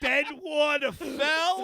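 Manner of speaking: slow, high hammed-up British; British accent. Next guess at a British show, in mock British accent. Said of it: "you can't say these words without British accent".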